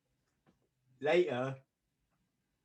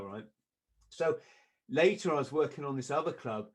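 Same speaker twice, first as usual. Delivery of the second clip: ordinary talk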